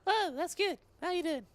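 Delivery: high squeaky cartoon voice; silly high voice. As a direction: pipe it up like a goofy goblin. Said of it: "I'll be this voice".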